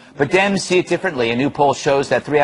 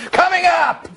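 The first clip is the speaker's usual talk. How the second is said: announcer shout; 12 semitones higher than usual. Acted. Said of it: "show tease".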